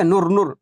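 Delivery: नूर said short, "nur"; not drawn out. Shown as a demonstration. Teacher repeats the mistaken reading, नूर kept short. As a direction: say नूर with a brief vowel. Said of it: "नहीं, नहीं पढ़ोगे ना".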